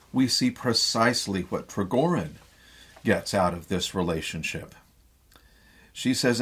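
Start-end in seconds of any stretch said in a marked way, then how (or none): none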